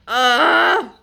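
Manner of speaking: loud, high cry of frustration; real exasperated yell; frustrated yell. Real, not acted. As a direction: let out a loud, rising groan-yell. Frustrated outburst over failed project.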